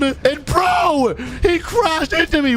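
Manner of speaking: real shout at the racer who crashed into him; outraged shout. Real, not acted. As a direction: yell it out as the other car rams you. Crashed into during a race.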